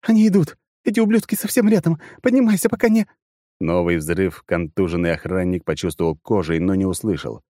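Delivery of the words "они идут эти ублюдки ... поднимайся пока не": high loud frantic yell; panicked shouting. Acted. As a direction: scream at him to get up. Partner urging under attack.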